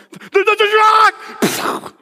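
very high, loud, stretched-out alarm; slow, shrieking cry of alarm; panicked warning. Retold or imitated. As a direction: yell as the truck looms. Warning friend of crash coming.

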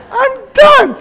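high, loud mock toddler whine; toddler wail. Father mimicking his toddler again through laughter, high squeal. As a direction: squeal it like a fed-up little girl. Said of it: "I'm just kidding".

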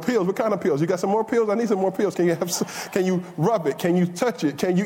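high-pitched, hammed-up pleading; mock desperate begging. Preacher imitating himself begging for pills once hurting, comically desperate. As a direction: play-act pestering someone urgently for relief.

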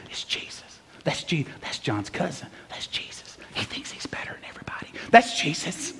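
hushed, gossipy whispers rippling through a crowd; excited stage whisper, barely voiced. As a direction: whisper it like onlookers murmuring to each other. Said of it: "you hear people whispering".

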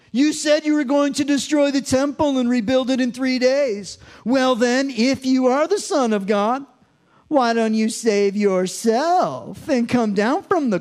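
high, loud sneering taunt; mock him with heavy sarcasm; sarcastic mockery. Pastor voicing the crowd taunting Jesus, loud sarcastic sneer. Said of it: "nay, I say the sarcasm".

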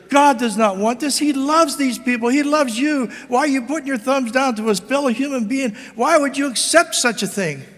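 preaching monk's outcry. Acted. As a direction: cry out to the crowd to stop the killing. Monk shouting at the Colosseum.

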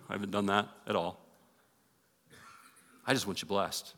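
ordinary talk

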